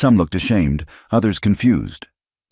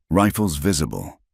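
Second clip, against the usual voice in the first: ordinary delivery, nothing marked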